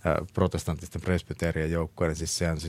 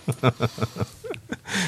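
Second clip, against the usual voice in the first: laugh; 7 semitones higher than usual. Real, not acted. Laughs after retelling the chant.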